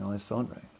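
usual speaking voice

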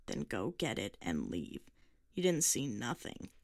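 low, slow, grumbling dismissal; gruff old man. A narrator's reading. Old man telling the girl to leave, low and slow. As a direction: send her away.

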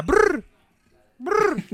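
high, loud pigeon trill; cooing pigeon voice. Acted. Pigeon noises.

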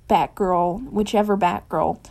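plain reading voice

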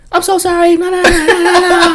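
very high, gushing mock apology; mimicked apologizing woman. Belligerent woman returns to apologize, mock-pleading falsetto. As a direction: imitate her rushing back in falsetto.

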